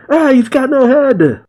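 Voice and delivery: high-pitched mock shriek of horror; shouted alarm. A narrator's reading. Usopp finds the body has no head, high alarmed shout. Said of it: "Usopp shouts".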